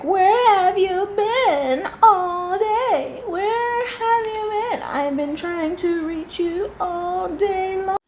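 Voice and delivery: high, slow, whiny mimicry of the wife; mocking her voice. Mocking the wife calling her husband, high whiny drawl. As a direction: stretch it out in a high nagging whine. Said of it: "and she was like".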